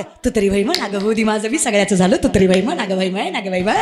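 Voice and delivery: mock a penniless lad pleading with a girl; pleading broke boy. Preacher mocking a broke youth pleading with a girl. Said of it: "तुम्हाला छपऱ्या पुरीला सुद्धा म्हणावं लागतं".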